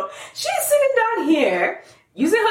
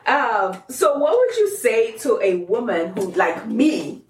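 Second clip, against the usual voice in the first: ordinary talk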